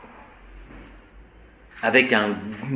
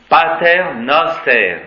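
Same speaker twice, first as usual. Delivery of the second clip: "Pater" stressed on PA, slow and louder; 3 semitones higher, 5 dB louder, slower than usual. Shown as a demonstration. Teacher showing Latin stress in the Our Father. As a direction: hit the first syllable of "Pater". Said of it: "l'accent est sur la première syllabe".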